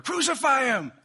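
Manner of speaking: high, full-force acted yell; loud, high-pitched cry for execution; mob shout. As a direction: shout it like an angry crowd. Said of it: "They're about to shout".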